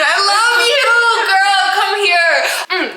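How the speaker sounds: high, loud excited call; excited shout-out. Real, not acted. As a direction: call out to her happily. Shout out [name].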